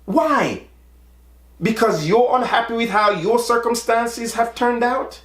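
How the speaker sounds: high, fast, incredulous outburst; exasperated. Retold or imitated. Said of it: "I'm like".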